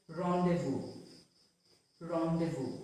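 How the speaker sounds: rendezvous as "ron-day-voo", falling at the end; silent Z. Shown as a demonstration. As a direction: say rendezvous with no Z sound. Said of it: "is silent".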